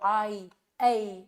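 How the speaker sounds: आई, ऐ drawn out very slowly, each sliding between two vowels; two-vowel glide. Shown as a demonstration. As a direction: stretch आई and ऐ to show the glide. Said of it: "दो vowels को आप easily बोल रहे हो". (आई, ऐ धीरे-धीरे खींचकर, दो स्वर मिलाते हुए)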